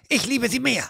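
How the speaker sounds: high, snippy rival thug. Acted. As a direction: snap back high and petty. Thugs quarrel behind the door. (der andere Ganove hinter der Tür: hoch und zickig)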